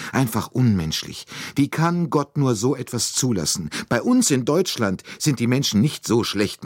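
a hint of a pious German maid, appalled; indignant maid. A narrator's reading. Lightly voiced maid telling Poirot how shocked she is.

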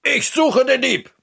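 high, determined outburst; angry call. Acted. Narrator vows to hunt the thief, an angry high call. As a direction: call it out in rising anger. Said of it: "Ich werde wütend".

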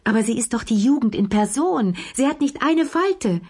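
high, lively insistence; emphatic protest. A narrator's reading. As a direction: object brightly, pitch leaping on the key words. Lea emphatically contradicting Madame Pelou.

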